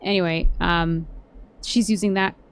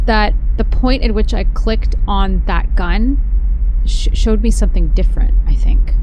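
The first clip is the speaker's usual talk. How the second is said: loud; 2 semitones higher, 8 dB louder than usual. Real, not acted. Loud confused musing over music.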